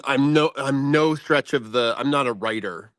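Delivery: normal talking voice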